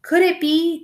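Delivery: plain reading voice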